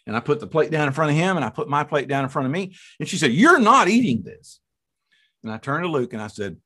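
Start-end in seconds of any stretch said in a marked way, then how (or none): 3.3-4.6 high, loud mock protest; put-on high, insistent voice; imitating adamant wife. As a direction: forbid it as an upset wife. Voicing wife forbidding the pie.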